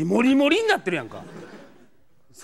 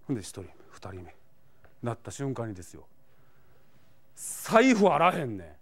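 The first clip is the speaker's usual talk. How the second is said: ordinary delivery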